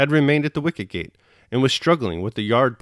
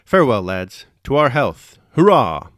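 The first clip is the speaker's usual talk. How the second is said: hearty cry; 2 semitones higher, 7 dB louder than usual. A narrator's reading. Anatoly toasting the lads farewell, building cry. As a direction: cry out the toast, louder to the end. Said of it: "he cried".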